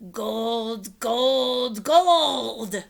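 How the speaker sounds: pirate captain gloating over gold; greedy pirate cry. A narrator's reading. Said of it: "he cried".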